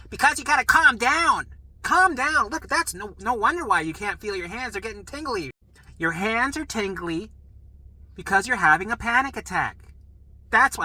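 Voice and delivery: high, loud scolding; exasperated yelling. Retold or imitated. Nurse telling patient it's a panic attack, exasperated yelling. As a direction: imitate a nurse shouting a patient down.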